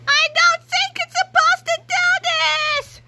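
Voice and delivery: high, slow shout of alarm over the noise; panicked shout. Acted. George shouting over the machine's noise, high panicked yell. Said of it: "shouted George over the horrible noise".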